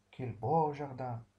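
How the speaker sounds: stretched-out words of admiration; admiring. Shown as a demonstration. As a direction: praise the garden slowly. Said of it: "un sentiment d'admiration".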